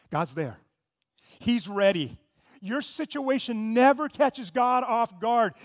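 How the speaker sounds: normal talking voice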